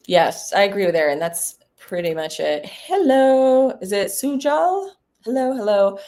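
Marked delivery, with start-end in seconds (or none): 2.7-6.0 calling hello up into the line; raised, sing-song phone greeting; checking if anyone is on the line. Real, not acted.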